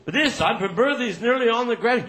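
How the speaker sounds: ordinary talk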